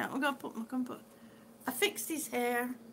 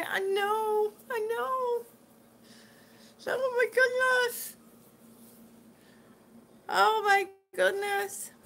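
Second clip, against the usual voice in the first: moved; 7 semitones higher, 3 dB louder than usual. Real, not acted. Touched by coming visitor.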